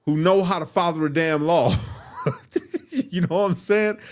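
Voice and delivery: loud, high, laughing at the irony; real disbelieving laughter; incredulous laugh. Real, not acted. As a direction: crack up at the absurdity.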